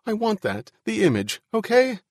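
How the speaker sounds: high-pitched, rising distress; anxious pleading. Acted. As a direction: plead as your nerve gives way, voice climbing. Ian despairs, pleading with Al.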